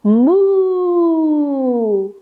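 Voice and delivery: drawn-out playful moo; cow moo. Shown as a demonstration. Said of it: "and say".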